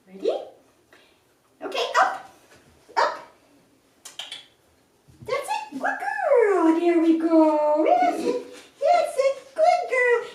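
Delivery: excited high dog-praise voice; puppy praise. Real, not acted.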